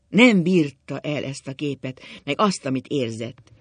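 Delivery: ordinary delivery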